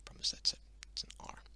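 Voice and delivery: a quick unvoiced side remark; real whisper to yourself after slipping up; whispered aside. Real, not acted. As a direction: whisper it under your breath.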